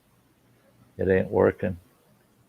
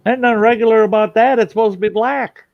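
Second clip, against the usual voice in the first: higher, louder and fast mock protest; retold indignant outburst; 10 semitones higher, 5 dB louder than usual. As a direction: fire it back as if outraged at the milky coffee. Retold argument over coffee.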